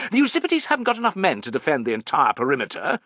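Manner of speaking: high-pitched, self-important lecturing; condescending. A narrator's reading. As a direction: point it out as though only you saw it. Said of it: "as if no one else would have seen this".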